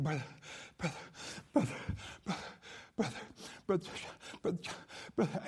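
halting, choked-up imitation of a sobbing boy; imitated sobbing. Heartbroken camp boy seeks out the preacher, mock sobbing. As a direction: gasp out each broken word between mock sobs. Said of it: "he's just sobbing".

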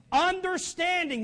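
"Understanding" slow, high and loud; stress on UNDERSTANDING. Shown as a demonstration. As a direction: stretch out "Understanding" loudly as the key word. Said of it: "That's a huge word".

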